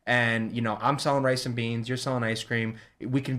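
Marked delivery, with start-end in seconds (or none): none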